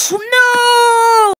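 high, loud wailing no; play-acted wail, high and loud; anguished cry. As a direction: cry out as the rocket leaves. Pretend despair at rocket.

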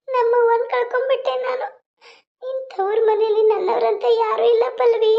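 high, slow grieving lament. Acted. Neela grieves her mother's death to her friend, sad and slow. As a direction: pour out the grief of losing your mother.

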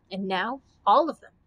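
usual speaking voice

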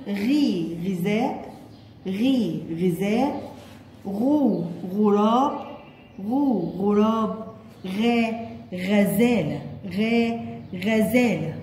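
slow, stretched "غي", "غو", "غا", each followed by its word "غذاء", "غراب", "غزالة"; sounding out syllables. Shown as a demonstration. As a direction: model each syllable slowly for kindergarteners, then "غذاء", "غراب", "غزالة" drawn out. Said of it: "ننطقهم كمان مرة يا كي جي". (نطق تعليمي بطيء بمد "غي" و"غو" و"غا" قبل كل كلمة)

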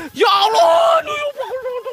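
very high, crying shriek; loud shrieked lament that fades away; wailing cry. Real, not acted. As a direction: shout out and collapse into sobbing wail. Crying out, later told not to cry.

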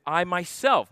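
"myself" leaned on, falling; stress on myself. Shown as a demonstration. Pastor stressing Jesus' "myself" to congregation, emphatic. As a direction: press the word "myself" firmly. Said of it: "But the emphasis is".

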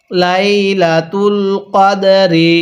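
slow drawn-out Quran recitation of লাইলাতুল কদরি; slow tajweed chant. Shown as a demonstration. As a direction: recite লাইলাতুল কদরি slowly, each syllable held. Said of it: "পড়ুন".

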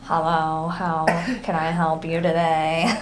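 low, slow stuffed-up greeting; Squidward-like phone greeting. Pretend. Joking about answering work phones while sick, glum low voice. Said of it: "Can you imagine answering the phones like this?".

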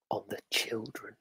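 ominous storyteller whisper. A narrator's reading. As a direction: breathe it out. Dreadful reveal whispered.

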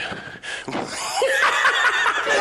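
high, wild cackling laugh; loud unhinged cackle; manic cackle. Acted. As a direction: let a crazed laugh burst out.

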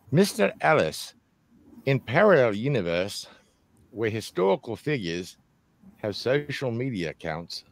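put-on British accent. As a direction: read out the question in your best British accent. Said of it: "with my best British accent".

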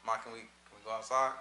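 put-on soft, low, timid kid asking mom; timid child asking. Child asking mom quietly if he can go outside. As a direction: ease the question in gently, like a shy kid.

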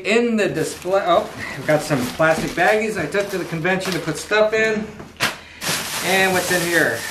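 normal talking voice

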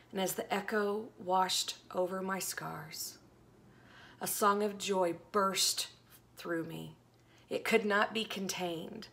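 earnest devotional poetry reading; dramatic poem reading. Acted. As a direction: read it like a heartfelt lament.

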